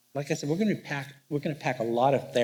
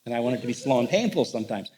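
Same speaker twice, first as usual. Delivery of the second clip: ordinary talk, nothing marked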